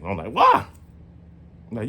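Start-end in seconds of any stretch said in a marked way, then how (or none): 0.3-0.7 a sharp, much higher why; startled why. Retold or imitated. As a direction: yelp it out in alarm. Alarmed reply to grandma.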